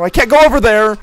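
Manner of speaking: high, loud complaint; frustrated shout. Real, not acted. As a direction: yell at the game in frustration. Frustrated in game.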